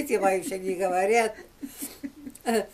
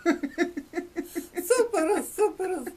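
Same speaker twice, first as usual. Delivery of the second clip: laughing; 7 semitones higher, much slower than usual. Real, not acted. Laughing over misheard punchline.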